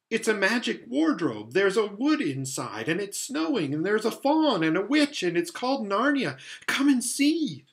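put-on high, eager young girl; tumbling out the wonder, young girl; excited. A narrator's reading. Lucy excitedly insists on Narnia.

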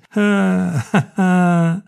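raucous belly laugh; wild guffaw. A narrator's reading. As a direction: laugh like you can't stop. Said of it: "ridendo tanto sgangheratamente".

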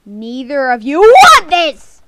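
loud, drawn-out game taunt; mock-threatening yell; shouted warning. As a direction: stretch it out and shout them off. Warning off rival players.

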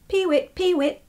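high, sung bird call "peewit"; lapwing cry. A narrator's reading. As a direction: chirp it like a lapwing. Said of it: "it sang".

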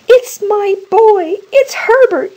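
high, loud, drawn-out cry; desperate cry. A narrator's reading. Old woman to husband, it's her son at the door, desperate cry. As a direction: cry out wildly, voice pitched right up. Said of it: "she cried".

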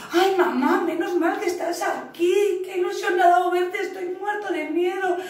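put-on high, excited little-boy voice; relieved scared kitten. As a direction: blurt out relief to find mum. Child cat relieved but scared.

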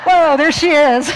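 loud, high, slow exclamation; amused surprise. Real, not acted. Speaker greets a comic picture appearing, loud amused surprise. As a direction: whoop it out through amusement.

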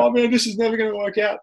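a high, half-joking panicked cry; mock panic. Retelling the flat tire before TV, mock panic. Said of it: "I'm freaking out, panic mode".

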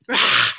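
low, short mock roar; playful lion roar; lion roar demo. Shown as a demonstration. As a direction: growl it like a lion for the class. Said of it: "Can I hear some rahs?".

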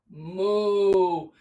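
low gentle cow moo. Pretend. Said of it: "lower your belly and say".

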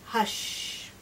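ordinary delivery

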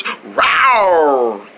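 one long, low rawr; tiger imitation. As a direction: stretch out a pretend tiger roar. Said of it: "Bonner likes tigers".

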